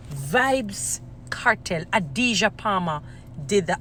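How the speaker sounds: slow emphatic naming. Real, not acted. Crediting the artist by full name, slow and emphatic. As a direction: announce the name with long, weighty pauses.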